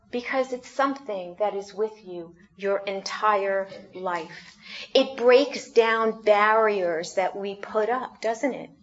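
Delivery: usual speaking voice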